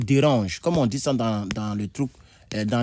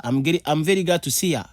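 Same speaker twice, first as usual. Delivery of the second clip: quick, higher American-style 'see ya', run together; casual American see ya; 4 semitones higher, faster than usual. Shown as a demonstration. Teacher demos the American way of saying goodbye, casual. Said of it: "L'anglais américain te dira to see ya".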